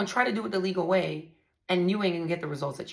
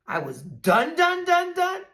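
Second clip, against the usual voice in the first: climbing louder and higher on each done; fed up, her voice rises with every done; building anger; 11 semitones higher, 7 dB louder, slower than usual. Real, not acted. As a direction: stack the dones into a near shout. Rising in anger at ex.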